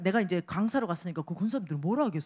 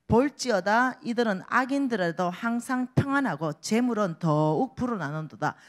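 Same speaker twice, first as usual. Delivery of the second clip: louder solemn Bible reading; scripture reading; 4 dB louder than usual. Acted. Reads scripture aloud. (힘있게 성경 봉독)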